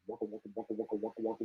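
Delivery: low, soft "waka waka" chopper sound; imitated rotor noise. Describing the gunship coming, a low soft chopper noise. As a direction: mouth a distant helicopter, low and hushed.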